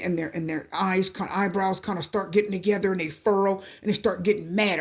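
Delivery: normal talking voice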